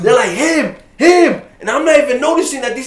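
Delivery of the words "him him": calling out. Retold or imitated. Crowd pointing him out.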